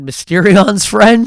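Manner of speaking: imitated bartender. Bartender sizing up taped guest, slow knowing query. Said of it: "bartender looked at him and said".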